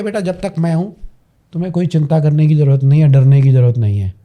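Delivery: firm, quick and dropping low; strong, reassuring. Shown as a demonstration. Strong mother to her child, firm low assurance. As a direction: reassure with total certainty, pitch sinking as it goes. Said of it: "और एक mother पूरी strong है".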